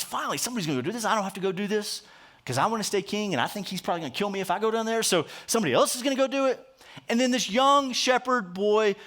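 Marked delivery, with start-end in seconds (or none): 0.0-6.7 quick muttered inner thought, fast; Saul's relieved thought. Retold or imitated. Preacher voicing Saul's inner relief, fast and muttered. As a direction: rattle off a cowardly king's private relief. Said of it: "He thinks".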